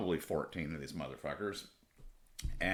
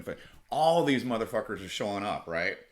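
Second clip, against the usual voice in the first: usual speaking voice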